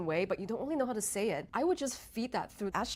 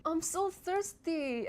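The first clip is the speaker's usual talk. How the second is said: "I'm so thirsty" slow and much higher; high dramatic take; 9 semitones higher, much slower than usual. Shown as a demonstration. Showing viewers pitch changing the meaning of "I'm so thirsty". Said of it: "you can hear the difference in the meaning".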